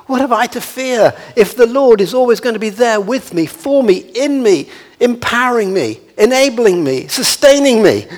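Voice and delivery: high-pitched, mounting list of assurances; fervent preaching. Real, not acted. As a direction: preach it as a confident, building challenge. Preaches rhetorical question with fervour.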